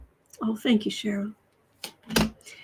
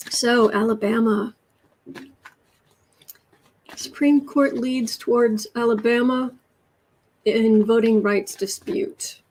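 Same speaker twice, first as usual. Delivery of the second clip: ordinary delivery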